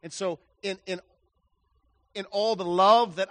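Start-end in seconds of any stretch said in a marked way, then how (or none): none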